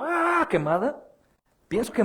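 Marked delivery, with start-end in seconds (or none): 0.0-0.5 short high mock scream of pain; imitated scream. Burning person screaming for help, imitated high scream. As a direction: cry out like someone on fire. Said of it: "una persona gritando".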